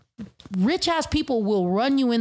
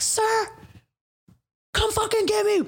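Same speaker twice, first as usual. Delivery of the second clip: a much higher, hammed-up taunt; mock defiance; 11 semitones higher than usual. Imagining taunting the cop who followed her, a high mock dare. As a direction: dare the cop, pitched way up in jest. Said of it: "I'd be like".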